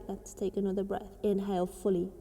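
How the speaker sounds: ordinary talk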